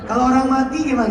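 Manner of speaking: ordinary delivery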